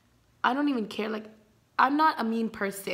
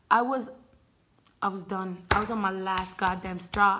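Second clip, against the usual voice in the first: usual speaking voice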